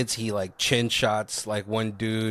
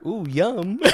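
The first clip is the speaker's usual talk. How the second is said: campy, higher ooh yum; mock delight; 8 semitones higher than usual. Relishing a Shirley Temple, campy and higher. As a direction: coo it like a treat.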